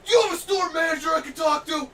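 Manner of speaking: loud, high entitled customer yelling; mock angry customer demanding the manager; demanding shout.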